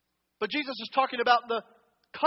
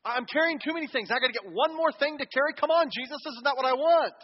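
whiny complaint; 3 semitones higher, 4 dB louder than usual. Retold or imitated. Complaining listener voiced by preacher.

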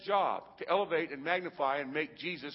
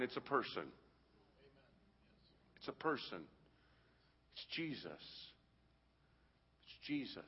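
hushed reverence; 3 semitones lower, 10 dB quieter than usual. Real, not acted. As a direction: drop to a hush to let it land. Hushed climax of the point.

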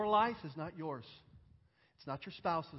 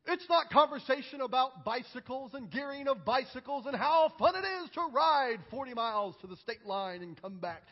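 high, loud, driving sermon delivery; fervent preaching; 7 semitones higher, 7 dB louder than usual. Acted. Preacher dismissing small talk about bicycles, forcefully. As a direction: pile up the list with raised voice.

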